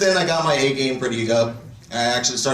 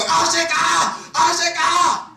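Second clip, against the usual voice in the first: high, slow, drawn-out yells; imitated Japanese man shouting; much slower than usual. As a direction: act a Japanese man's involuntary outburst. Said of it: "He just keeps on shouting out in Japanese".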